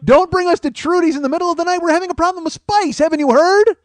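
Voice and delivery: high, loud mock kids' complaint; whiny kids. Imitating kids whining about a late night out, high and loud. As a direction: play the tired kids begging to go home. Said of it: "That's the kids going".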